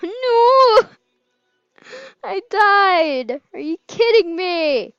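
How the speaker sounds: loud, high outraged yell; frustrated shout. Real, not acted. Eliminated in the round, yelling in frustration. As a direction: yell in disbelief at dying. Dying in the game.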